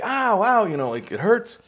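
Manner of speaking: high mock yelp of pain; imitated pained cry. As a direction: squeal it like the shocked man. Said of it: "the guy would start screaming, like".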